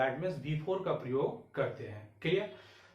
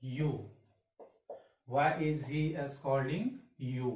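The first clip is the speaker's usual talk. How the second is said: slow dictation; much slower than usual. Shown as a demonstration. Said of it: "teacher dictates the sentence".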